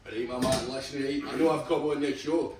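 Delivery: swaggering Scottish hard man; Scottish accent. Shown as a demonstration. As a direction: boast in a Scottish accent, amused.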